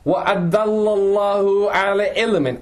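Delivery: fast, chanted Quranic recitation set apart from his talk; tajweed recitation. Acted. Teacher reciting the verse before explaining it, in a chanted tajweed voice.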